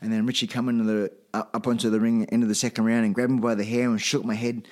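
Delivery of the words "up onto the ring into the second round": usual speaking voice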